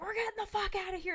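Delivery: quick, high-pitched bolt for the door; mock panicked exit. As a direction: act out someone fleeing fast. Voicing character fleeing the body.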